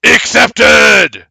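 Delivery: very loud, high, sudden shout; shouted reveal after a pause. Acted. Statues accepting the barbarian's apology, sudden loud shout. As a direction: hold the pause, then blast it out.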